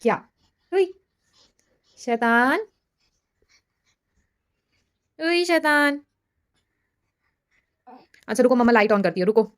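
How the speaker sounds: high playful cooing, calling him a rascal. Real, not acted. Mother playfully calling her baby a little rascal, high cooing.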